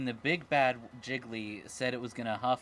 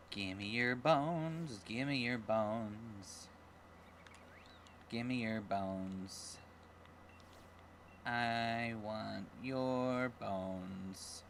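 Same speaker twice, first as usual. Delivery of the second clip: very slow, low, softer voice; creepy bone monster; 4 semitones lower, 5 dB quieter, much slower than usual. Pretend. Player voicing a Pokemon demanding bones, slow low creepy growl. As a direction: drag the words out like a ghoul craving bones.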